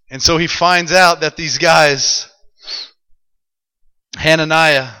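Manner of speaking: normal talking voice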